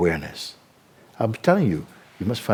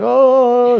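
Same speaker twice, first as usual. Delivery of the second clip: high, loud yelp of panic; a startled, hammed-up yelp mid-river; panicked cry; 9 dB louder than usual. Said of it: "everybody started to panic".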